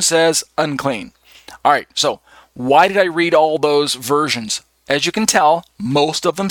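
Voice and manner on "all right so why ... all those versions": ordinary talk, nothing marked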